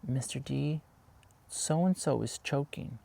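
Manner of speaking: put-on high, soft and slow polite child; polite student voice. Student politely tells teacher about choking, high soft voice. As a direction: ask timidly like a well-mannered kid. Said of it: "Polite voice".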